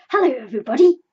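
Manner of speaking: loud, bouncy greeting; a cheery tiger bursting in; cheerfully. A narrator's reading. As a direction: Tigger booming a happy hello. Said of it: "said Tigger cheerfully".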